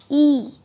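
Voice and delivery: ई held long, as a stretched "ee"; teacher demonstrating a long, sustained ई; drawn-out long E. Shown as a demonstration. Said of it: "खींचकर".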